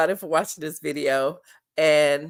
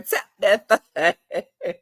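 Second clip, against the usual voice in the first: laughing, drawn out; much slower than usual. Retold or imitated.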